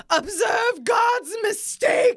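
loud, high, drawn-out proclamation; dramatic movie-line quote. Acted. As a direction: proclaim it grandly, stretching every word. Quotes favorite film line dramatically.

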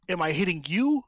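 ordinary talk, nothing marked

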